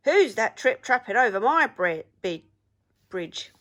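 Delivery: loud booming bellow with a stumble; bellowing troll. A narrator's reading. Troll bellowing at the sound over his bridge, loud. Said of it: "he bellowed".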